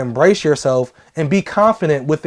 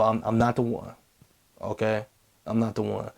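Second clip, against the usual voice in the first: bass in the voice; 7 semitones lower, 12 dB quieter than usual. Shown as a demonstration. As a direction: lower and much softer, deep. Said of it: "a little bit of bass in y'all voice".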